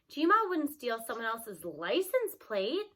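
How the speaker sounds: plain reading voice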